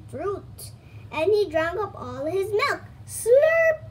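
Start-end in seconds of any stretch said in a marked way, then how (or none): 3.1-3.8 high, loud mock slurp; playful drinking sound effect; slurping noise. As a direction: make the sound of gulping milk.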